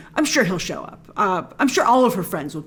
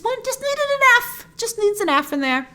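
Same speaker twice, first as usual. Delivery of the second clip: squeaky little aside, held long; playful high sing-out; high-pitched joking; 11 semitones higher than usual. Real, not acted. Joking high-pitched fix for title.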